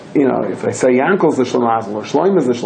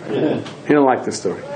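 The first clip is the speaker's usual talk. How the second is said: ordinary talk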